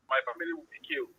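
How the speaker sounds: ordinary talk, nothing marked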